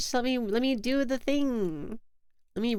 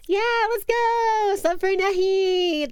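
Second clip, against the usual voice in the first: higher, louder, drawn-out hype cheer; excited cheer; 9 semitones higher, 4 dB louder, slower than usual. Real, not acted. Streamer cheering a gifted sub, excited and loud. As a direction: celebrate the sub like a win.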